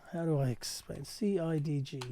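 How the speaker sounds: ordinary talk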